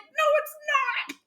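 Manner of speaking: very high, playful denial; mock denial. Player refusing to believe it's her friend. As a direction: squeak out a stubborn no. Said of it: "I'm like".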